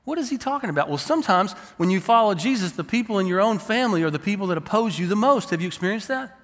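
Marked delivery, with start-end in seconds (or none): 0.1-0.9 higher, incredulous second take; baffled. Retold or imitated. Reader repeating the question, higher and baffled. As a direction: ask it again in disbelief.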